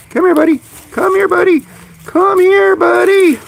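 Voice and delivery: very high singsong pet call; high coaxing falsetto call; bird call. Real, not acted. Calling a pet bird over.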